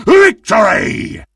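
high laugh bursting into a victory yell; cackle, then holler in triumph; gleeful high shout of victory; triumphant cackle and shout. Acted. Celebrates winning the game.

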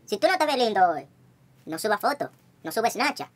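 ordinary delivery

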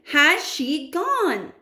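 high, loud and drawn out; boy shouting slowly down to grandmother; shouted, slow. A narrator's reading. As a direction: call down slowly, fearfully. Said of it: "I shouted again".

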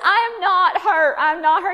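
loud, high mock protest; imitated yelling. Pinned wife to panicking husband, imitated loud insistence. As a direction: holler it out to head off panic.

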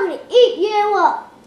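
loud, high monster roar; loud troll threat. Acted.

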